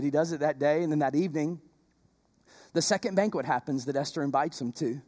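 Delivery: low, soft sermon narration; hushed storytelling. Acted. Preacher telling the congregation the story, hushed. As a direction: draw the congregation in quietly.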